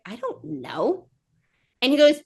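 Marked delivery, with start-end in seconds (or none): 0.0-1.1 slow, low, much softer reply; small drawn-out mock admission; sheepish admission. Woman cannot tell date his address, sheepish.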